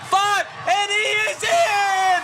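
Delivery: high shouted rising play-by-play; touchdown call. Real, not acted. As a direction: build the call to a roaring touchdown. Touchdown run called live.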